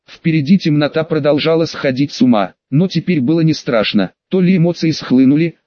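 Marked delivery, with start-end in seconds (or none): none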